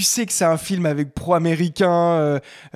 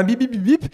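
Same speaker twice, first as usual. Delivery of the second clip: beeping imitation; 7 semitones higher, 3 dB louder than usual. Imitates computer alarm beeps.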